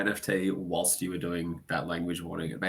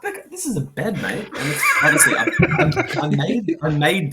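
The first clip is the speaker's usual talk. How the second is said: higher, louder, laughing through the words; laughing hard; 10 semitones higher, 10 dB louder than usual. Real, not acted. Mate laughing as he defends his prison-cell bed to friends. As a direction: laugh helplessly while protesting.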